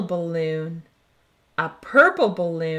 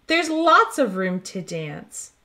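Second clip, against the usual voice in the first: plain reading voice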